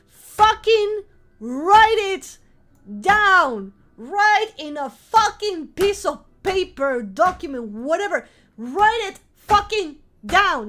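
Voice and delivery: loud, high, drawn-out command; forceful shouted insistence, stretched words; exasperated shouting. Real, not acted. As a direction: yell each word slowly like an order. Exasperated shouted order to viewers.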